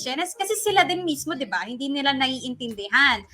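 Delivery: ordinary talk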